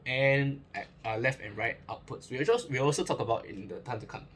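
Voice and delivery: ordinary delivery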